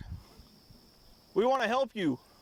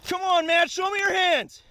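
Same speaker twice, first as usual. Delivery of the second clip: shouted surrender demands; 7 semitones higher than usual. Real, not acted. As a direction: shout the order repeatedly. Commanding the downed suspect.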